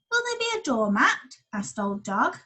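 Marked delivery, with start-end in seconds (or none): 0.1-1.4 high, hopeful dog voice; the dog asking for a doormat; hopeful old dog. Acted.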